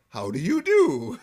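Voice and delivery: lightly voiced disguised Homer; put-on posh greeting. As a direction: greet with a fake formal voice. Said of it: "Homer's like".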